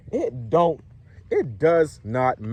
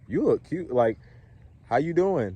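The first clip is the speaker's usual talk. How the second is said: higher-pitched compliment and greeting; up-talking greeting; 4 semitones higher than usual. Shown as a demonstration. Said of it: "Don't say it like that".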